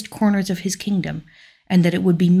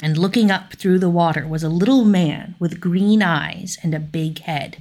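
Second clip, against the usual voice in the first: ordinary delivery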